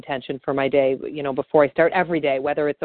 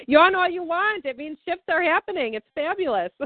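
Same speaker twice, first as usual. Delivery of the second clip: laughing delight; 13 semitones higher than usual. Retold or imitated. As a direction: giggle through it, thrilled they're yawning. Gleefully reassuring yawning client.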